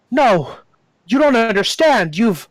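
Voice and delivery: hammed-up alarm, breaking off mid-sentence; acted desperate protest. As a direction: protest urgently, pitch jumping up. Frantic character, eyes go wide.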